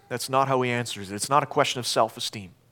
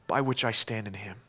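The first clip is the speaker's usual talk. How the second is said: normal talking voice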